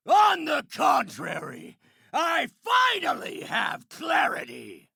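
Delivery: furious battle yelling; impatient, indignant villain tirade; enraged villain shout. Acted. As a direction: shout your frustration at the enemy. Villain rages in a fight.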